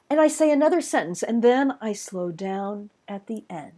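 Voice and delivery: singsong fall that slows into "at the end"; predictable slowing rhythm. Shown as a demonstration. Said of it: "a predictable rhythm".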